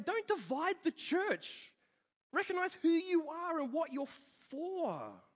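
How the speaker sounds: high, emphatic preaching plea; urgent exhortation. Retold or imitated. Preacher voices Paul urging the church, emphatic. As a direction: press each phrase home, pitched high.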